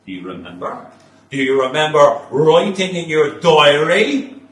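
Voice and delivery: from a hush to a roar; rising interrogation. Acted. O'Brien building to a shout.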